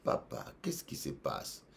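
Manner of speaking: soft unvoiced whisper to the troubled pastor; gentle whisper. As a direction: breathe it like a worried friend. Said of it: "Je dis". (murmure doux et inquiet à un ami)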